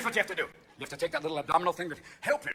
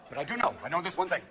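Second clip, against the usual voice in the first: ordinary talk, nothing marked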